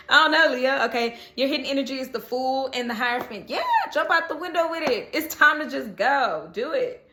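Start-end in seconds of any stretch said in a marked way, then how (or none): none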